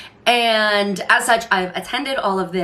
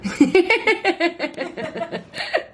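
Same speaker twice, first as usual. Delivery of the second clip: high, drawn-out laugh; 9 semitones higher than usual. Real, not acted. Vlogger laughing before the intro, high laugh. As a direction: let out a long high-pitched giggle.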